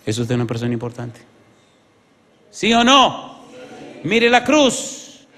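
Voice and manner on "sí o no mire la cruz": high, loud demand; full-force pulpit call, pitched way up; preacher's urgent challenge. Real, not acted. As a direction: shout it out to the congregation. Preacher demands answer from congregation.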